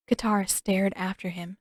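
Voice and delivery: ordinary delivery, nothing marked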